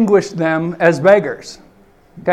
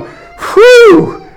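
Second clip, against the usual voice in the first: loud, very high whoosh of relief; relieved whew; 8 dB louder than usual. Retold or imitated. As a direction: blow out a big relieved whew. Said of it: "like, going".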